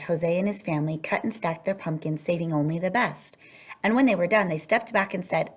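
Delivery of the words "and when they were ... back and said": ordinary delivery, nothing marked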